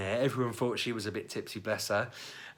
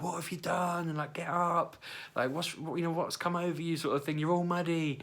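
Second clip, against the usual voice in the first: exasperated wife; 8 semitones higher than usual. Retold or imitated. Wife scolding drunk husband.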